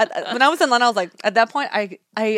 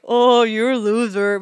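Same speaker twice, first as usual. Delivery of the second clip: slow, drawn-out teasing jeer; mock taunt; 4 dB louder, much slower than usual. Imagined voice calling her a loser, taunting. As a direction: stretch it out like a playground taunt. Acting a mocking name-caller.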